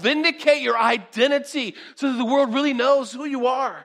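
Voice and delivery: high, loud, coaxing devil; preacher voices Satan tempting Jesus, loud coaxing. Acted. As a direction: lure him like the tempter, rising and pushing.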